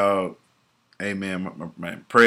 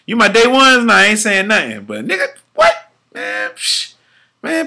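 worked-up disbelief; 9 semitones higher, 3 dB louder than usual. Retold or imitated. Scoffing at snitching on new friends.

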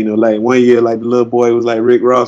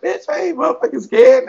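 high-pitched acted retort; indignant and unimpressed, high in the voice; scoffing defiance. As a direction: act out brushing off the scare, voice pitched way up. Acted retort to a scare actor.

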